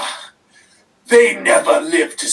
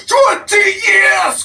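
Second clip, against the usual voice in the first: even higher, slow, full-volume roar; furious shout; 13 semitones higher, 5 dB louder, slower than usual. Acted. Wrestler repeating his grievance, higher furious shout. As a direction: scream it, the anger peaking.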